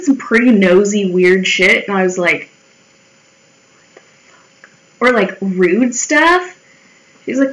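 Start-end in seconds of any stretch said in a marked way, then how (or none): 3.7-4.7 whispered disbelief. Retold or imitated. Reacting to nosy coworker.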